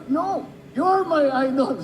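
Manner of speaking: slow, high no, you're my idol; mock captain reply. Celtics captain answering Manny, slow and high. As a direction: insist it back, drawn out. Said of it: "sabi niya sa'yo".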